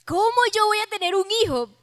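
high, quick, disbelieving outburst; incredulous Sara. Acted. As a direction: squeak out the question in acted disbelief. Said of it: "pensó ella, hizo así".

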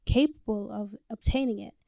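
ordinary delivery

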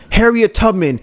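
high, impassioned motivational speaker; hammed-up motivational speech, pitched well up; Eric Thomas. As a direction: preach it with rising intensity. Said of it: "he's a motivational speaker... he would go".

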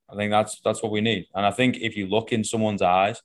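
ordinary delivery

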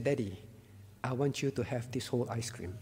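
imitated child's very soft, low-pitched offer; hushed and tender, voice dropped right down. Child hands daddy the whole ice cream, tenderly.

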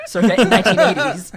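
laughing mock British voice, loud and high; laughing put-on British accent. Shown as a demonstration. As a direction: toss it off in a hammy British accent. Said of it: "My British voice is Fisher Stevens".

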